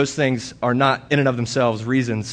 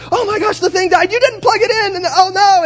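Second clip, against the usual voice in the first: much higher, panicked mock wife; frantic wife; 4 dB louder than usual. Husband imitating his frantic wife after the baby monitor died, panicked. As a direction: squeal it like a panicking mother. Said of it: "I woke up to a frantic wife".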